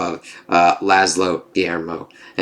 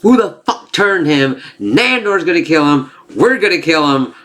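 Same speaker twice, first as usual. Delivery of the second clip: mock angry outburst; 8 semitones higher than usual. Recapping Nadja at the clinic, a hammed-up angry rant. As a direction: play up the vampire's rage at her familiar being turned. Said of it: "She's pissed off".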